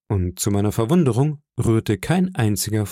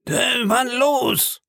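high, drawn-out battle cry; cursing; 14 semitones higher, much slower than usual. Acted. Old sailor urges attack on the boy, cursing. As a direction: egg them on with a long, high call. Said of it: "sagte Morgan mit einem Fluch".

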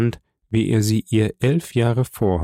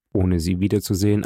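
ordinary delivery, nothing marked

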